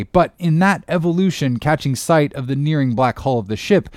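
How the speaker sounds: ordinary delivery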